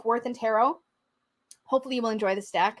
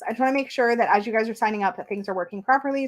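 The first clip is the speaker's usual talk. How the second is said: usual speaking voice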